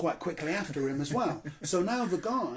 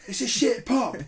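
louder, higher retold rant at the nervous stranger; mock rant; 7 semitones higher, 6 dB louder than usual. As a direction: bark it like a fed-up local. Pub guy scolding the stranger.

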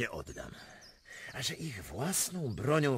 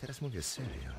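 usual speaking voice

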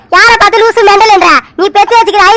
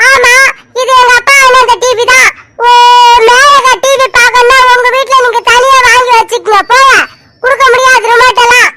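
put-on higher bratty little-girl voice; cheeky child; 3 semitones higher than usual. Little Aisu talking back to her aunt, cheeky child voice. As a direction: talk back like a spoilt child.

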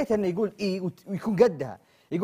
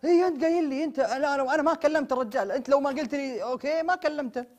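raised, insistent protest; angry complaint; 8 semitones higher than usual. Acted. Friend scolding Mitab for agreeing, raised and insistent. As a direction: blame him for saying okay.

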